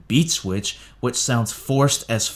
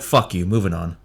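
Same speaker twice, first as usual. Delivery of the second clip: ordinary delivery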